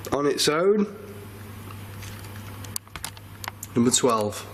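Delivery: drawn-out bingo calls, rising on own; bingo caller. Pretend. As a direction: call the numbers like a bingo hall. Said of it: "Let's pretend to play bingo".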